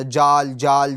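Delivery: جال said short with a j sound; wrong zaal with j. Shown as a demonstration. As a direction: show the mistake, جال with a j. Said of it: "تو یہ غلط ہو جائے گا". (ذال میں جیم کی غلط آواز)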